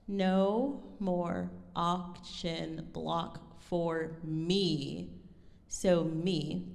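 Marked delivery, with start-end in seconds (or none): 0.1-5.1 very slow, soft, word-by-word reading towards "me"; drawn-out, softer reading that lingers on "me"; accent on me. Shown as a demonstration. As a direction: spell out each word slowly, holding "me". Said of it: "This first accent mark, if we think about the text".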